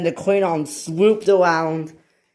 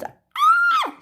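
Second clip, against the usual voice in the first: very high squealed shriek; tiny scream way up high. Acted. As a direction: squeal "ah" at the top of the voice. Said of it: "she screamed".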